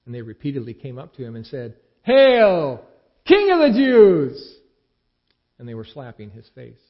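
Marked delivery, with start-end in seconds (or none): 2.0-4.6 loud, high, slow mock salute; hammed-up jeering cheer, much louder; mocking hail. A narrator's reading. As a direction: sneer it like jeering soldiers. Soldiers mocking Jesus.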